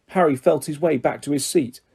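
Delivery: ordinary delivery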